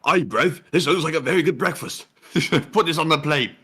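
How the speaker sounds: hammed-up British slang accent. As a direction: do a jokey British bloke. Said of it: "The British come along saying".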